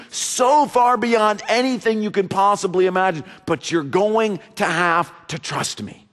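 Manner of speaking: high, loud, driving sermon delivery; preaching at full force. Acted. Pastor urging the congregation, at full force. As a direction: preach it out to the whole church. Preacher voicing God's promise loudly.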